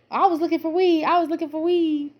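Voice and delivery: high-pitched mimicked excuse; mock excuse. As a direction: imitate him pleading innocence in a high voice.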